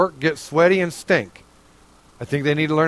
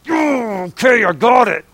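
chastened realization; 4 semitones higher than usual. Retold or imitated. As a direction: caught out and conceding. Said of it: "And I'm like".

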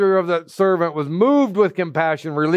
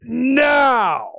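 grab him by the collar and demand it; furious creditor shouting for payment; angry demand; 10 semitones higher, much slower than usual. Acted.

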